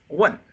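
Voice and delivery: "wen" starting high and falling, loud; short, high, falling "wen"; fourth tone. Shown as a demonstration. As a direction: drop "wen" sharply from the top. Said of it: "high to low".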